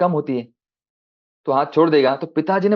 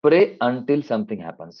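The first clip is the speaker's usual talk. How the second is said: slow deliberate; much slower than usual. Shown as a demonstration. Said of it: "ये पुश क्या है?".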